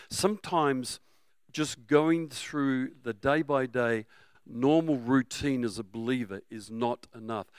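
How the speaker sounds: usual speaking voice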